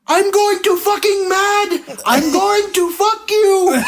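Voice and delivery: high, slow, laughing mock shout; hammed-up meltdown. Prankster retelling the fake worker threatening his cameraman, high slow mock shouting. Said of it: "he starts freaking out".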